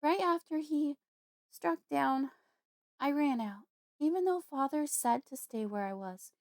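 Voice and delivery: put-on young woman reliving a trauma; upset young woman.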